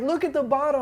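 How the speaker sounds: high-pitched retold jeer; imitated urging. Coworkers urging the hot sauce victim to look, high and insistent. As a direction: act out onlookers pointing him to the answer. Onlookers urge him, retold.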